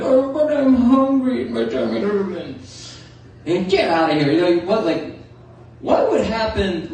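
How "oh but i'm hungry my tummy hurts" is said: high, slow, whiny cartoon bear; bad cartoon-bear impression; Pooh Bear. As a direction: plead slowly like a pitiful hungry bear. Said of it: "that Pooh Bear impression".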